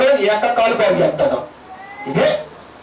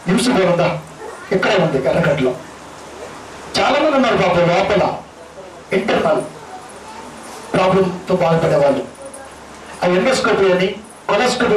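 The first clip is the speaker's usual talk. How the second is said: ordinary talk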